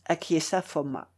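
plain reading voice

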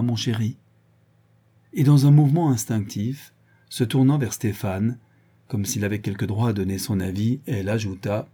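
ordinary delivery, nothing marked

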